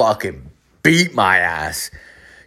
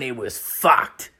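usual speaking voice

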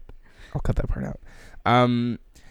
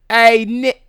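high, loud New York holler; New York accent; 11 semitones higher, 11 dB louder than usual. Shown as a demonstration. Host copying co-host's greeting, shouted New York accent. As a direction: holler it like a brash New Yorker. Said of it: "in a New York accent".

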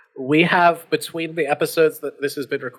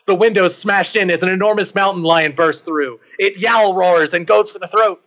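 loud, raised, urgent storytelling; dramatic action narration; 6 semitones higher, 5 dB louder than usual. A narrator's reading. Narrator as a mountain lion bursts in, dramatic excitement. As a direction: hit the attack hard, keep the energy up.

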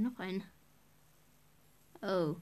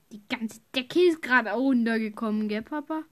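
calling out; 9 dB louder than usual. Real, not acted. Calling to dad across room.

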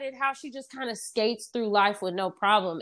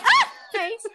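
laughing thanks; 12 semitones higher, 7 dB louder than usual. Retold or imitated. Retold Holly thanking flatterer.

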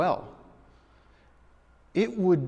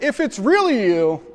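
Peter voice; 10 semitones higher, 13 dB louder than usual. Retold or imitated. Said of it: "watch this, guys".

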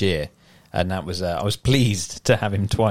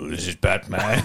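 a touch lower, mock Batman growl; disguised deep voice; 4 semitones lower, 3 dB quieter than usual. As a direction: drop into a half-hearted Batman voice. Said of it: "come on, just pick a voice".

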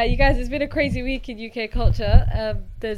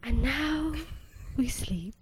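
soft whispered meditation voice; sleep meditation voice; 10 dB quieter, slower than usual. Shown as a demonstration. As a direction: drift into a hushed sleep whisper. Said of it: "you could do sleep meditation with your voice".

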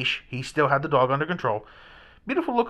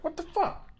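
pitched way up, rising in shock; startled cry; 4 dB quieter than usual. Retold or imitated. Man jumping at a dog's bark, high rising startled cry. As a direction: squeak it out in sudden alarm.

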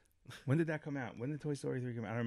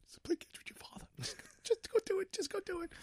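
tearful, squeaky whisper; choked-up plea; 8 dB quieter than usual. Retold or imitated. Moved viewer pleading with the son, tearful whisper. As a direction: whisper it as if fighting back sobs. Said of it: "I'm just like".

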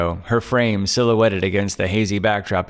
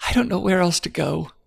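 higher, relieved frightened woman's voice; frightened young woman; 10 semitones higher than usual. Acted. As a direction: play Claire, scared and clinging to hope.

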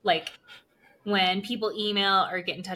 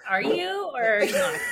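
higher, pleading ask; anxious questioning; 10 semitones higher, 4 dB louder than usual. Retold or imitated. Mom asking daughter if she means it. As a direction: press her for the truth. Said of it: "and I'm like".

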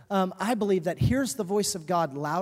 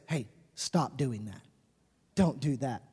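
quiet parental correction; 7 semitones lower, 5 dB quieter than usual. Retold or imitated. Parent speaking once child looks.

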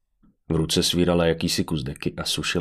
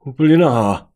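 much higher and louder, stunned; astonished blurt; 7 semitones higher, 8 dB louder than usual. A narrator's reading. Martin gapes at the naked woman, astonished. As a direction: exclaim it in disbelief.

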